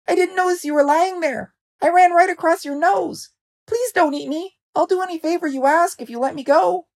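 very high, pleading mouse voice; squeaky mouse. A narrator's reading. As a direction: beg for your life in a tiny squeak. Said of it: "squeaked the mouse".

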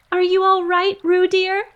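high, loud worried call; anxious call. A narrator's reading. Kanga calling to Roo in the water, anxious high call. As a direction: call out anxiously to your child. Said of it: "called Kanga anxiously".

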